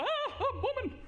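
hammed-up scientist fleeing in fright; shrieking in mock alarm; mock panic. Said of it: "Women, they go".

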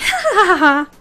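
loud, high put-on laugh; big forced ha-ha-ha; demonstration laugh. Shown as a demonstration. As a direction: burst out laughing on purpose to clip the mic. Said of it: "laugh again, that was the best laugh ever".

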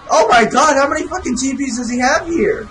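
high-pitched, loud outburst; exasperated disbelief. Real, not acted. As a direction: exclaim in incredulous exasperation.

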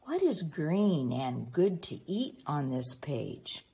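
usual speaking voice

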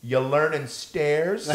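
loud, high, drawn-out tease; teasing call. Retold or imitated. Brian Regan teases a friend climbing stairs, teasing call. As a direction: rib him loudly, stretching it out.

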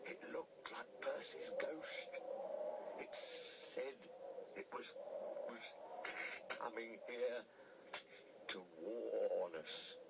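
slow, stammering, frightened; halting, spooked whispery stammer; shaky voice. A narrator's reading. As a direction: falter between words, trembling with fear. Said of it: "said Toby in a shaky voice".

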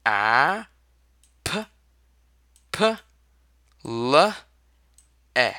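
each letter sound said loud and separate; sounding out phonics. Shown as a demonstration. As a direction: punch out every letter sound on its own. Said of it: "look at the sounds of each".

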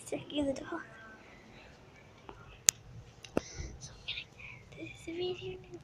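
hushed whisper. Real, not acted. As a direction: whisper near the sleeping dog. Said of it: "Be really quiet".

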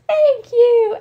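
squeaky high little thank you; mock thanks. Streamer thanking a donor in a squeaky voice. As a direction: chirp it way up high.

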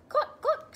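high hen clucks; high clucking. Acted. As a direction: cluck like a chicken. Chicken clucks in story.

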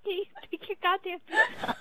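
imitating a fed-up delivery guy, high voice; mock exasperation, pitched high; so done. Pizza guy to customers after waiting, mock fed-up. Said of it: "was just so done with us".

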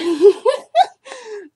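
high, loud burst of laughter; laughing. Real, not acted. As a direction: laugh off the cat's antics. Laughing at cat chaos.

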